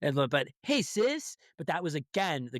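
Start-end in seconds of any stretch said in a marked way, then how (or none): 0.6-1.4 hammed-up TV kid greeting. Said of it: "Nobody called their sister sis".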